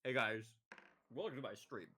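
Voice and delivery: badly faked deep streamer voice; forced deep voice. Streamer greeting viewers during deep-voice experiment, forced deep. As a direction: open the stream in an unconvincing deep voice. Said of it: "I made my voice deep for one week".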